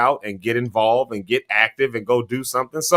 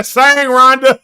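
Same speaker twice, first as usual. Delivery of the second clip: high, loud cheer; raised-pitch shout of encouragement; calling out encouragement; 11 semitones higher, 7 dB louder, much slower than usual. Retold or imitated. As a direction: holler it out like cheering on a singer. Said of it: "I said".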